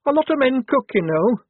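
high, loud indignant retort; hot protest. Acted. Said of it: "said Shufti hotly".

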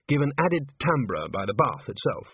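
plain reading voice

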